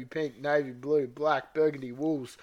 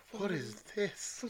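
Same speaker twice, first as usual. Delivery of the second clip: higher, soft, drawn-out question; hushed horror; 6 semitones higher, 7 dB quieter, slower than usual. Acted. Reader redoes Severus's line after whispering with horror, hushed. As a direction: ask it slowly under your breath, aghast.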